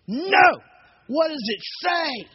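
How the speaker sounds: loud, very high furious outburst; screaming. Retold or imitated. Angry stranger demanding his tattoo be read, screaming. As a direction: scream it drawn-out, right in his face. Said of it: "screaming at me".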